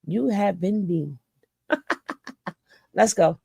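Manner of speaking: ordinary talk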